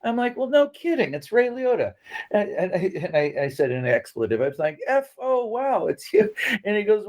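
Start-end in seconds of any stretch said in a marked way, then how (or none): 0.4-1.9 high, sarcastic "no kidding"; mock the caller with raised pitch; sarcastic disbelief. Answering the phone to a stranger, high sarcastic disbelief. 4.9-6.3 high, stunned "wow, it's you"; starstruck gasp. Retold or imitated. Realizing Ray Liotta is calling, high starstruck surprise.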